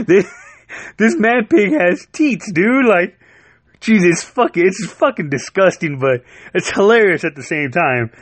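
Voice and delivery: high laughing squeal of disgust. Real, not acted. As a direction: laugh through it, grossed out and delighted. Grossed out by pig teats.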